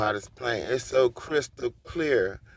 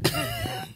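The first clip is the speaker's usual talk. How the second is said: short laugh; 4 dB quieter than usual. Real, not acted. Laughs about being at work.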